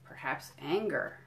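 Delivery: slow, lilting picture-book read; reading to children. Acted. Museum educator reading a picture book to kids, storytelling. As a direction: savour each item for little listeners.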